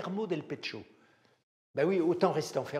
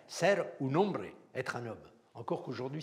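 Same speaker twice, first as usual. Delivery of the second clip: normal talking voice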